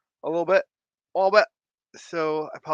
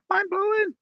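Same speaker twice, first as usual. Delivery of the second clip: Mind-blowing squeaked much higher; emphatic high repeat. Shown as a demonstration. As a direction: pitch Mind-blowing up in amazement. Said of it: "repeated emphatically".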